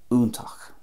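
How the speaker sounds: "úntach" as OON-takh; Munster pronunciation. Shown as a demonstration. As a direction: say "úntach" with a definite oo sound. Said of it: "a definite oo sound".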